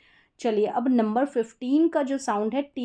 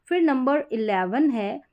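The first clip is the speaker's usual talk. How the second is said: normal talking voice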